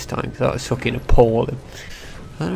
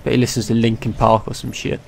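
ordinary delivery

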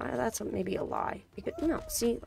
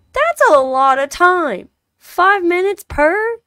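outraged disbelief; 7 semitones higher, 18 dB louder, slower than usual. Real, not acted. Outraged at game's time demand.

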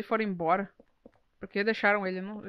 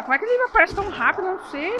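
high, fast, panicked question; scared and rushed; alarmed outburst; 8 semitones higher, 3 dB louder, faster than usual. Real, not acted. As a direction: blurt it out in alarm. Monster reappears suddenly.

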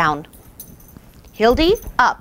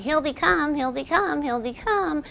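softer, worn-out repeated call; each Hildy, come fading softer; nagging repeat; 5 semitones higher, 7 dB quieter than usual. Shown as a demonstration. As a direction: nag her again and again. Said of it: "don't keep going".

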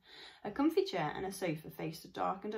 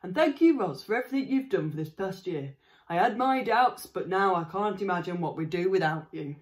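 louder, heartfelt gruff father voice; grateful farmer; 9 dB louder than usual. Acted. Father thanking the robot at dinner, heartfelt gruff voice. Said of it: "father thanks the robot".

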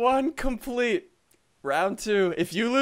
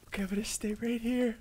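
whispering; 3 semitones lower, 13 dB quieter than usual. Real, not acted.

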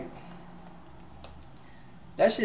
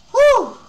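shocked exhale; 9 dB louder than usual. Real, not acted. Reaction after song.

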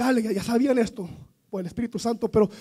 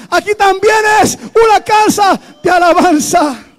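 repeated even louder and higher, near a shout; louder proclamation; 8 semitones higher, 12 dB louder than usual. Real, not acted. Preacher repeating the declaration to the congregation, shouting louder. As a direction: hammer it home the second time.

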